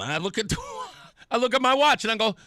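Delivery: normal talking voice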